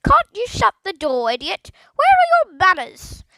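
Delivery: high, loud squawk; scolding parrot. A narrator's reading. Parrot scolding Philip face to face, loud high squawk. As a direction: screech the rebuke.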